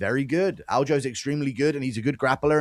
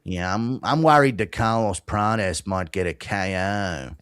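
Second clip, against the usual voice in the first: put-on Aussie accent; 5 semitones lower, slower than usual. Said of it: "You went from fucking Aussie to fucking".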